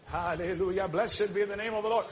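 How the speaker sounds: normal talking voice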